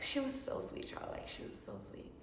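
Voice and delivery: ordinary delivery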